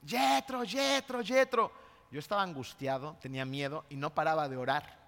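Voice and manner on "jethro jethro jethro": a father calling for his missing son, mild mock yell; calling out a name. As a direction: search the trail calling for a lost son. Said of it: "yo iba grite y grite y grite".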